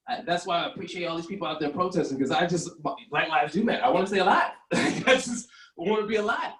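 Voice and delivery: normal talking voice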